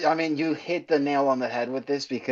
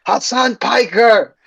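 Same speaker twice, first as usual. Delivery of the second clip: mock Irish accent, pitched higher; fake Irish accent; 8 semitones higher than usual. Comedian threatening a streamer, mock Irish accent. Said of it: "in a full Irish accent".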